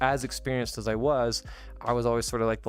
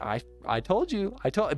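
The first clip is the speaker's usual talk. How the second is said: much higher, triumphant repeats of I told you; gloating; 8 semitones higher than usual. Retold or imitated. Gloating at red-eyed coworker, high triumphant I told you. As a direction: crow over being right.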